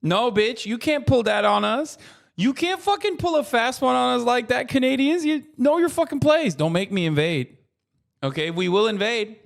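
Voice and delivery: high-pitched hammed-up threat; mock outrage. Streamer threatening Canadians in jest, hammed-up outrage. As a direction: bluster at Canada like a pretend warlord.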